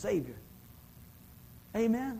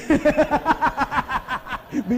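big high burst of laughter; loud laugh; 7 semitones higher, 11 dB louder than usual. Real, not acted. Pastor laughs at a congregation member's tease, loud laugh.

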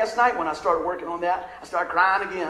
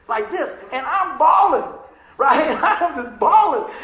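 higher and louder, breaking into laughter; laughing through it; 6 semitones higher, 8 dB louder, slower than usual. Real, not acted. Preacher laughs at himself bawling over the car. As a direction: laugh as you tell how you wept. Laughing while retelling crying.

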